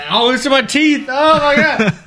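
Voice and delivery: very high, panicked disgust; real revolted wail; freaking out. Real, not acted. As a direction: cry out with bitter hops stuck in the mouth. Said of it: "freaked the F out".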